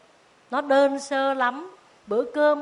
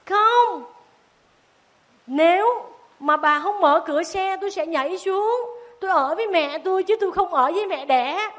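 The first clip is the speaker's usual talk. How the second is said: put-on high, raised child's protest; defiant child; 6 semitones higher than usual. Tí threatening to jump out of the car, high and defiant. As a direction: refuse hotly like a stubborn kid.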